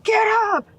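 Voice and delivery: high, loud barked order; loud, high drill sergeant shout; drill sergeant yell. Retold or imitated. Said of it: "they're like".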